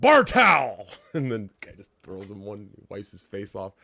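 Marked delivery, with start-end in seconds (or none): 0.1-0.9 mock shout. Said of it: "and he turns and goes".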